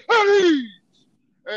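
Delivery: loud high shout of hey; sharp call. Retold or imitated. Sergeant bursting into the room with a loud high shout. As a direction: bark it at the door.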